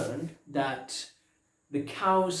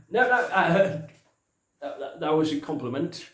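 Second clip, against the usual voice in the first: loud, hurried reassurance; hasty backpedalling; 4 semitones higher, 9 dB louder than usual. Acted. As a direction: jump in louder to take it back. Hastily takes back a threat.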